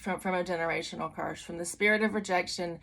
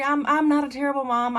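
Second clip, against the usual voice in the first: high, loud mock-panicked protest; hammed-up flustered self-defense; anxious pleading; 7 semitones higher, 5 dB louder than usual. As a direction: stammer it out, pitched way up and pleading. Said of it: "like, anxious and".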